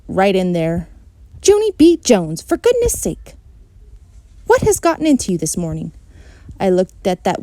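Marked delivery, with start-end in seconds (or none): 1.4-5.9 a hint of a fed-up mom; exasperated mother. A narrator's reading. Mother scolding her noisy daughter, exasperated.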